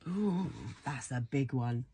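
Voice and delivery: ordinary delivery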